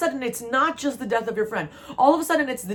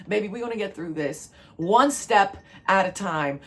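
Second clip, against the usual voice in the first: ordinary delivery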